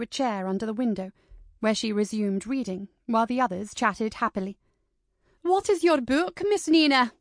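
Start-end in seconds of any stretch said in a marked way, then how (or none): 5.4-7.1 put-on raised voice of the older lady calling across the room. A narrator's reading. Lady Janet calls to Nina across the parlour, a little raised. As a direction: call across to Nina, a bit high and louder. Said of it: "called Lady Janet".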